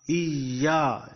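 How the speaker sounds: ordinary talk, nothing marked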